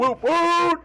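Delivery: very high, gleeful woot; excited cheer. Acted. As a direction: whoop like a party crowd. Hype cheer for the show.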